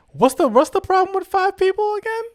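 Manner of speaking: high-pitched mock confusion; incredulous high-voiced retold question; puzzled questioning. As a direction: pitch it way up, querying the obvious. Acts out questioning the driver.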